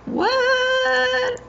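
very high, drawn-out squeal; disbelieving squeal. Real, not acted. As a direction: stretch it high in happy disbelief. Disbelief at first level 99.

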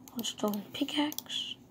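whisper. Real, not acted. Whispered crafting aside.

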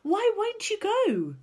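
high, slow, loud plea; pleading cry. A narrator's reading. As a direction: draw out the question, very high. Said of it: "Sophie cried".

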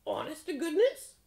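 high, loud, eager crow. A narrator's reading. Crow checking the frog's promise, high loud crow voice. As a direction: squawk the question up high.

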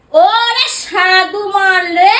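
slow, drawn-out mocking singsong; singsong mock admiration; sarcastic jeer. Real, not acted. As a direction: stretch it out to taunt the other person.

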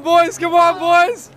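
ordinary talk, nothing marked

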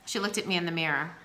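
plain reading voice

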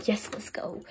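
quick hushed whisper. Pretend. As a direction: whisper it under your breath. Sneaking along.

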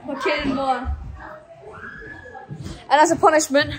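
usual speaking voice

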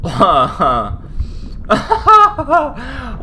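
drawn-out laugh with pauses; real laugh. Real, not acted. Gamer laughing at what turned up in the room, real laugh. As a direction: burst out laughing, climbing high.

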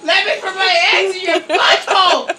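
playful spider demand, pushed harder; pleading spider. Pretend. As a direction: beg loudly as the hammed-up spider. Louder repeat after "Please!.

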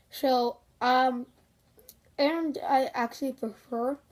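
speaking around a mouthguard; with mouth guard in. Real, not acted. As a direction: teeth clamped on guard. Seen 1: Dark mouth guard plainly clenched in mouth behind helmet facemask throughout.

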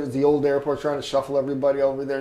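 usual speaking voice

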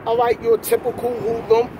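ordinary talk

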